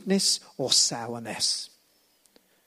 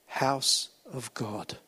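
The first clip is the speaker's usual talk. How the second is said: slow reveal; 4 semitones lower, 4 dB quieter, much slower than usual. Real, not acted. Meaning revealed slowly.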